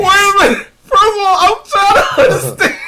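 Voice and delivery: very high, loud disbelief; incredulous outburst. Real, not acted. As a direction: squeal your protest, close to cracking up. Comic outburst of disbelief at joke.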